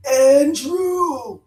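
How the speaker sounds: high, loud drawn-out dog howl; dogs howling a name. Retold or imitated. Guest mimics uncanny dogs calling her brother, howling. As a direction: howl the name out long like a hound. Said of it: "they stop and they howl".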